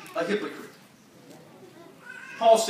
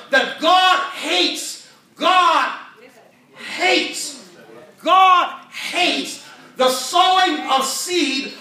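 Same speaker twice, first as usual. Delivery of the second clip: preacher hammering God hates; slow stressed preaching; 5 semitones higher, 6 dB louder, much slower than usual. Real, not acted. Preacher to congregation, slow heavy stress on God. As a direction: drag out God hates, leaning on God.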